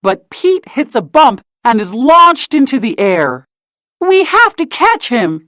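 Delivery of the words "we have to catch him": high urgent call to catch him; a storybook shout, high and eager. A narrator's reading. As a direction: Minnie calls out, pitched high and urgent. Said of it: "shouts Minnie".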